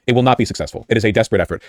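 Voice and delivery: ordinary delivery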